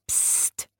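soft whispered shush; a quiet, smiling shh; hushing psst. A narrator's reading. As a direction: hush them gently with a psst. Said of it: "lächelte der kleine Zoowärter".

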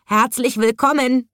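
high croaky parrot voice; parrot squawk. A narrator's reading. Colourful parrot greeting the new zookeeper, squawking high. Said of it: "und krächzte".

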